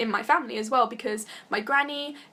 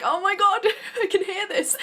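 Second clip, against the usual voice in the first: excited; 10 semitones higher, 3 dB louder than usual. Retold or imitated. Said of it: "being like".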